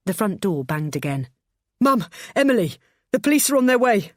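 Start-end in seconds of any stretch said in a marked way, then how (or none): 1.8-4.1 high, loud breathless calling out. A narrator's reading. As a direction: call up the stairs out of breath. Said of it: "called out James breathlessly".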